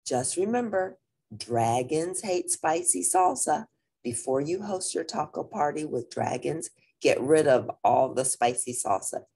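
lively storybook reading; reading to children. A narrator's reading. Teacher reading a picture book to the class, lively. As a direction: read it out to the kids, bright and clear.

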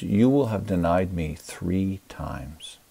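ordinary talk

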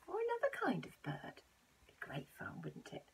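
whispered encouragement; soft whisper. Real, not acted. Encouraging children to draw, soft whisper. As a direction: whisper it warmly. Teasing sign-off.

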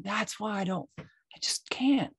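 whispered, slow at the end; whispering to mom in the truck, worn out. Retold or imitated. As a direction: breathe it out in a whisper. Quiet aside to mom in truck.

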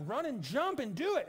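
hammed-up angry coach to yourself; mock angry self-urging. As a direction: egg yourself on in mock exasperation. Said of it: "It makes me so angry".

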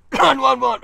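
forcing words out between loud coughs; through a coughing fit. Real, not acted. Vlogger fighting a chesty cough while talking to camera, coughing. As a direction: cough hard, then push the words through. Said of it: "Bit chesty today".

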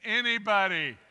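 loud, high, forlorn call; toddler calling. Retold or imitated. Grandpa imitating the toddler's last loud, high call for anybody. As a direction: yell it out into the dark. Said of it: "Finally, he says".

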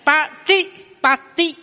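very slow patipati, retroflex then dental t; very slow demo. Shown as a demonstration. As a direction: stretch out patipati to show both t. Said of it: "hai chữ ti này đọc khác nhau".

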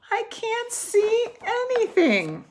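high, complaining voice; whiny Toad. A narrator's reading. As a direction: whine it, dazzled. Toad blinded by the sun.

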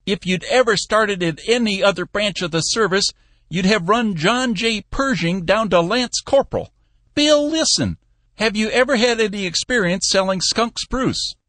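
put-on an old boss tickled by the joke; amused old Cappy. A narrator's reading. Cappy teasing Bill about skunk spruce, high old man.

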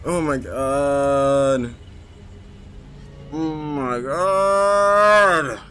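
very slow, drawn-out despair; defeated groan. Real, not acted. Gamer after another death, slow despair. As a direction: stretch it out, exhausted.

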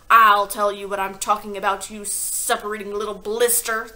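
loud furious retort; shouted. A narrator's reading. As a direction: shout it at the boy. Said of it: "the Trunchbull shouted".

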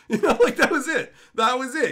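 loud laugh through the words. Real, not acted. Laughing at the shop owner's reaction, loud.

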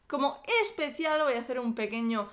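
ordinary talk

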